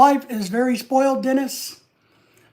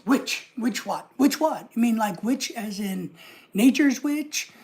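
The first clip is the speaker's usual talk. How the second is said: ordinary talk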